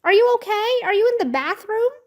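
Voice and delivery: high-pitched, frantic questioning; freaking out. A narrator's reading. Babysitter running to find the girls, freaking out. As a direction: call out in panic, rising questions. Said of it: "hear Nancy freaking out".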